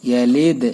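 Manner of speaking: ইয়ালিদ with a bouncing echo on the final দ; ইয়ালিদ with a popping end; qalqala on yalid. Shown as a demonstration. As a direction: make the দ in ইয়ালিদ jump. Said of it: "এখানে কলকলা".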